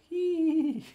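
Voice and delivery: high squeal laugh. Acted. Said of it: "with her anime voice like".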